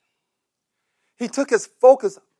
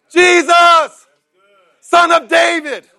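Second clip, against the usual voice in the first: high, loud, slow cry for mercy; desperate cry; 8 semitones higher, 7 dB louder, slower than usual. Acted. Blind beggar calls to Jesus, high desperate shout. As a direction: call out to Jesus from the roadside.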